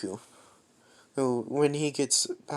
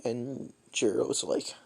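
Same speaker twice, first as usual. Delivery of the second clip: ordinary delivery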